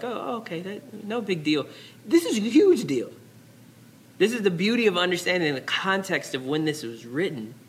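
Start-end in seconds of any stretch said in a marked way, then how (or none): 0.0-1.7 soft, high-pitched casual shrug; breezily unbothered, lightly tossed off; no big deal. Acted. Modern readers react to the verse, casually unimpressed. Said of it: "we're like". 2.0-3.1 HUGE pitched high and punched; emphatic correction. Real, not acted. Preacher corrects a casual reading, emphatic on "huge".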